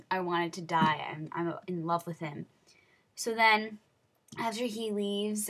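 usual speaking voice

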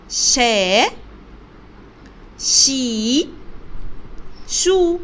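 شا، شي، شو each sounded separately with a long vowel; letter-sound demonstration. Shown as a demonstration. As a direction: model شا، شي، شو one by one, drawn out for learners. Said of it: "كل شكل بالصوت الصحيح".

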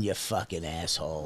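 low, under-the-breath jab; muttered insult. Real, not acted.

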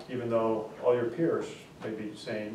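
ordinary delivery

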